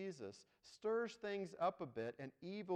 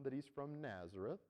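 normal talking voice

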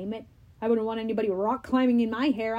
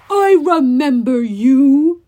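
loud, slow, enraged giant; furious; 12 dB louder, slower than usual. Acted. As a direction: roar it with rage. Cyclops voiced in audiobook.

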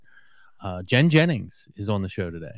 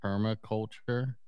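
soft, slow, mumbled permaculture; too quiet; 6 dB quieter than usual. Real, not acted. Host says the word to co-host, too quietly. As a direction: say permaculture softly and slowly. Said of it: "I can't even hear you".

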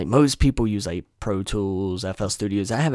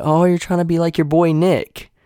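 teasing friend; 9 semitones higher, 6 dB louder than usual. Retold or imitated. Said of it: "And I was like".

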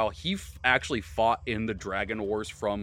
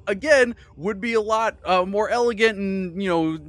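flustered hostess voice; 6 semitones higher, 5 dB louder, faster than usual. Acted. Missus Fox voiced again.

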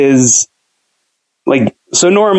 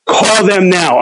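urged higher and quicker, friend pushing him; urgent order; 6 semitones higher, slower than usual. Retold or imitated. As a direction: hurry him up, pitched up. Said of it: "He's like".

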